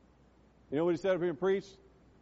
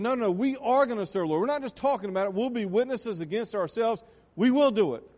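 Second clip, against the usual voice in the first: loud, raised voice of a people vowing; insistent declaration; 5 semitones higher, 7 dB louder than usual. Retold or imitated. Israel answering Joshua that they will serve, loud insistence. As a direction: act out the people insisting, hitting the last vow hard.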